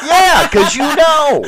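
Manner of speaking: words through a high laugh; laughing speech. Real, not acted. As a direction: answer while still laughing. Laughing while answering.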